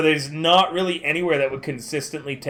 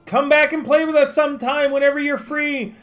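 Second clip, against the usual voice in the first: calling out; 11 semitones higher, 3 dB louder than usual. Real, not acted. Still calling to friend.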